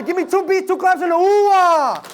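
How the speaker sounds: high chanted call to the crowd; hype call. Shown as a demonstration. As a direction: lead the chant, pumping them up. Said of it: "we're gonna put them together".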